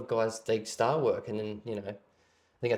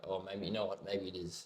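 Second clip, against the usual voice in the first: low, much softer imagined inner voice; quiet self-doubt; 4 semitones lower, 12 dB quieter than usual. Retold or imitated. User second-guessing the habit one day, quiet dawning doubt. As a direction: drop right down, as if admitting it to yourself.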